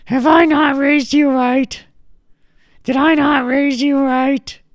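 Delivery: wounded granny scolding; 상처받아 꾸짖는 할머니 목소리; high, loud granny, slow and hurt. Retold or imitated.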